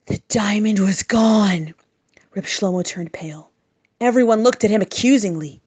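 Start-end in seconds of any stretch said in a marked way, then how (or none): none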